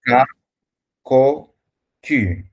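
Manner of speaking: "Ka", "Ko", "Ku" each with a hard K before the vowel; teacher sounding out "Ka", "Ko", "Ku" as a hard K; Ka Ko Ku sounds. Shown as a demonstration. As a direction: tutor demonstrates hard K syllables "Ka", "Ko", "Ku". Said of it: "Now it's going to sound as K".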